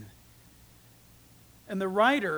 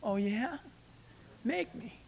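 softer, taunting dare; defiant dare; 10 dB quieter than usual. Retold or imitated. Churchgoers defy the rough policeman, soft taunting dare. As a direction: lightly dare him to try. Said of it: "the rest of us would say".

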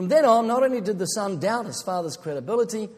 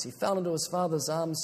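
ordinary talk, nothing marked

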